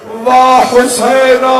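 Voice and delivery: loud, very high, slow keening cry; final wail. A narrator's reading. Sister lamenting her brother last of all, very loud high wail. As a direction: let the last name break out as a high wail.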